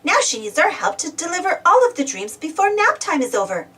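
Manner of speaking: ordinary delivery, nothing marked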